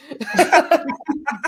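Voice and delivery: amused burst of laughter; a friend laughing at the question; knowing laugh. Retold or imitated. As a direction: laugh it off as if obvious.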